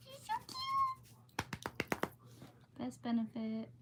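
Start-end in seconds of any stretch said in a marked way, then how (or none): 0.1-2.0 very high, drawn-out coo at the pet; slow high-pitched pet talk. Real, not acted. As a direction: gush over the cute dog, stretching every sound. Cooing over her pet.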